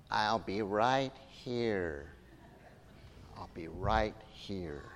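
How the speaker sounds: tender parting words, slowly; E T's farewell. Retold or imitated. E T saying goodbye to Elliot, slow and tender. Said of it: "and he says, um, goes".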